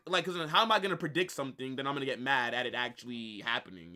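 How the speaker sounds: ordinary talk, nothing marked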